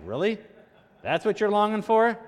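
can't believe that's what they want; incredulous. Acted. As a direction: react in mock disbelief, pitch climbing. Mock disbelief at Israelites' longing.

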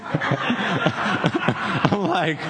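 usual speaking voice

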